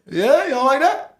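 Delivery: high, loud playful challenge; host teasing his guest; playful shout. Real, not acted. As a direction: call him out teasingly.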